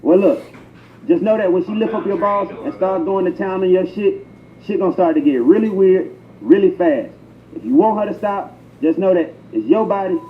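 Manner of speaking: ordinary delivery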